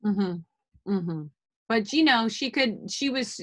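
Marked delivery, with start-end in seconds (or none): none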